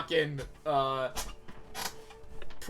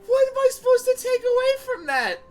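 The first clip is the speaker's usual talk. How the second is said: shrill disbelieving outburst, much higher and louder; incredulous high shout; 11 dB louder than usual. Real, not acted. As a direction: yelp in baffled disbelief. Stunned by abrupt ending.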